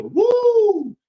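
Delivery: high, loud imitated crowd woo; crowd cheer. Mimicking the stadium crowd, loud high woo. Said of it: "You can hear the crowd say".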